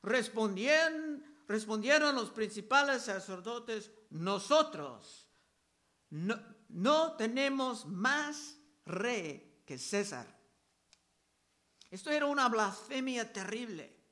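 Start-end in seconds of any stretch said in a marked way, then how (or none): 4.1-10.3 each word dragged out slowly; slow, grave reading of the chief priests; slow emphatic quote. Acted. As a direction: spell out the priests' words with slow weight.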